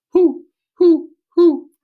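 high, loud hooting owl; owl call. Acted. Reading about zoo owls, hooting high and loud. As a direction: hoo like an owl in the trees. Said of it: "owls that call".

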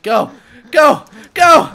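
short, loud, high bursts; urging shout. Real, not acted. Player urging on a play, excited shouting. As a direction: yell it like you're pushing the ball in.

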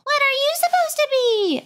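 put-on high, squeaky little girl calling out; a high girlish call. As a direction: pitch way up like a kid in a clown suit. Clown girl calls to Carly.

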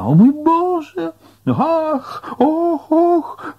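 very high, drawn-out shriek of horror; horrified screaming. A narrator's reading. Terrified Gretchen cries out as her husband is hit, horrified screaming. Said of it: "krzyczała".

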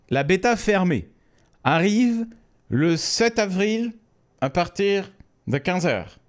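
mock ceremonious announcement, slowed right down; odd announcer voice. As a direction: spell out the date like a grand announcement. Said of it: "Je sais pas pourquoi je parle comme ça".

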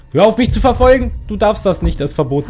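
high, loud panicked shout; scared yell. Real, not acted. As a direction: yell at the thing chasing you. Yelling at pursuing monster.

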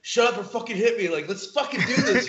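heated reenacted dare; retold fight challenge. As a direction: goad him like you're itching to brawl. Retelling own challenge in fight.

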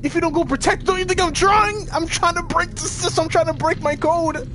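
high-pitched, strained pleading; frantic desperation. Real, not acted. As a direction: protest in a panic while struggling. Struggling urgently to break code.

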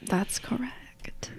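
breathy whispered agreement. Acted. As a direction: hush it out. Whispered confirmation.

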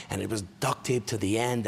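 ordinary delivery

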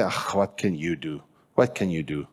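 low, soft mock jeer. The enemy mocks the believer, mocking. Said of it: "enemy will mock you".

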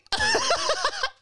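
high-pitched burst of laughter; a real, helpless giggle. Real, not acted. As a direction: crack up at the absurdity. Laughing again at the story.

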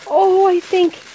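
high, loud, excited rush of recognition; overjoyed recognition. Real, not acted. Creator unboxing a friend's gift on camera, overjoyed. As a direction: she realises it's the mouse she loved.

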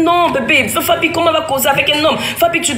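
a half-acted upset cry in the mall; retold crying out. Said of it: "Tu as commencé à crier".

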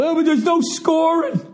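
very high whiny mock voice; mocking basketball fans. Parodying basketball fans dismissing hockey, high and whiny. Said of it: "Basketball people, they're all about".

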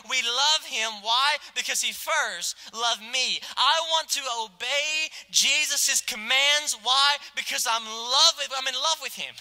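fervent preacher build; preaching. Acted.

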